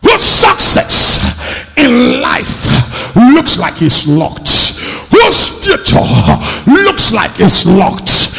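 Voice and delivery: building preacher's declaration. Acted. Preacher declaring deliverance to the church, building to shouts. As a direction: climb steadily until you're declaring it.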